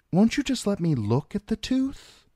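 mildly pleading, voice lifted high. A narrator's reading. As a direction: plead gently, pitched well up. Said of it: "Iza pleaded".

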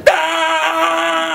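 high piercing scream; mum's scream. A narrator's reading. Mum sees the spider is loose: high scream. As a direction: let out a shrill scream of panic. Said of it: "And then she screamed".

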